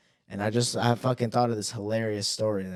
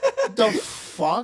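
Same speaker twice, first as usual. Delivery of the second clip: laughing slow disbelief; 12 semitones higher, 5 dB louder, much slower than usual. Retold or imitated. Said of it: "We're like".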